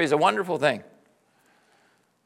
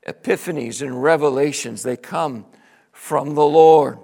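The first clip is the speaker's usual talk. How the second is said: ordinary delivery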